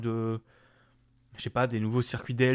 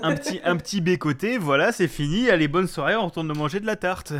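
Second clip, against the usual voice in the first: mocking; 5 semitones higher, 4 dB louder than usual. Mocking the quick knockout.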